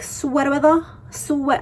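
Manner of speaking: put-on quick high Boston accent from the SNL skit, half-hearted. She quotes the SNL skit in a jokey Boston accent. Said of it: "I can't even do the Boston accent".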